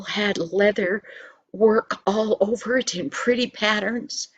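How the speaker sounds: ordinary delivery, nothing marked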